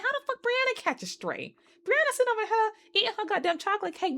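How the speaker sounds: very high, squeaky outrage; incredulous protest. Acted. Protesting on Brianna's behalf.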